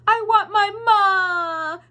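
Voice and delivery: slow, high, loud sobbing cry. Acted. Mig crying for her ma, slow high sob. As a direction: wail for your mother. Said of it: "cried Mig".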